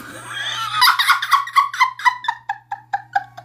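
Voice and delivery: a long, real, high laugh; shrieking with laughter. Real, not acted.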